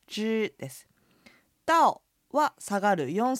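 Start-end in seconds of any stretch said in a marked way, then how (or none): 0.1-0.9 知 in the steady first tone. Shown as a demonstration. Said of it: "伸ばす音の一声".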